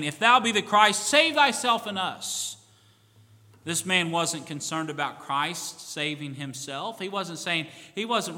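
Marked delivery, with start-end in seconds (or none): 0.0-2.5 hanged criminal railing at Jesus, loud and bitter. Acted. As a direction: rail at him in bitter anger. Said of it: "railed on him".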